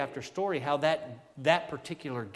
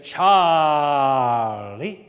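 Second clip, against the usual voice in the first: Charlie stretched out in a slow Southern drawl, louder; long Southern drawl; 12 dB louder than usual. Retold or imitated. Imitating his Alabama preacher friend's Southern drawl on Charlie. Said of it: "you talk about a long drawl".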